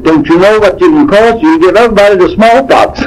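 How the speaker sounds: high, alarmed scolding. Retold or imitated. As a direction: scold him in shock.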